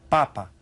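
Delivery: Papa said slowly and clearly, stress on the first pa; clear model word. Shown as a demonstration. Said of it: "Listen to it".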